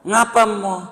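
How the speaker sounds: high squeaky calf voice; calf's voice. Acted. Calf questions its mother, high squeaky voice.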